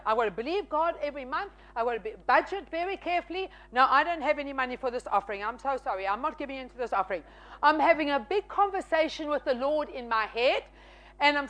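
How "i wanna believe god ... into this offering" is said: fast, tumbling excuses; rushed excuses. Retold or imitated. Student makes excuses to God, fast and tumbling. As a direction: rattle off reasons not to give.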